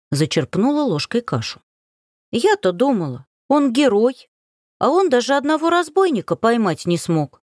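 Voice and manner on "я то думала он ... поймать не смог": mocking. Varenka mocks the hero.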